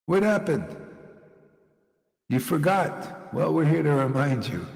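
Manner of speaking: normal talking voice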